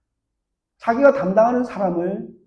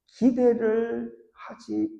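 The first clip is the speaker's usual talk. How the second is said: normal talking voice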